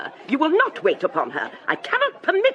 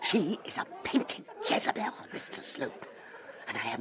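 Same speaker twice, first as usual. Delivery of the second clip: hissed whisper; 5 semitones lower, 12 dB quieter than usual. Acted. Hissed insult about the Signora.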